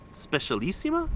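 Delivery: doubtful repeat of the name; puzzled question. Real, not acted. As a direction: check the strange word aloud. Puzzling over the bike name.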